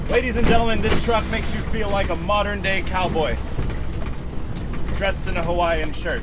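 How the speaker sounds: loud showman voice, jolting; on a bumpy road. Real, not acted. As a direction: announce it over the bumps. Showman line while driving bumpily.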